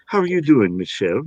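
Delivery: light British accent, mild put-on; a quick British accent on request. Shown as a demonstration. British accent performed for the interviewer. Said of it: "Can you give me an, a, a British accent?".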